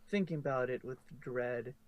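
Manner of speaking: ordinary delivery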